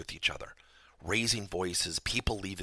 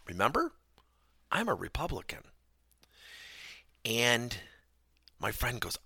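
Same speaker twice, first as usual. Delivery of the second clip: normal talking voice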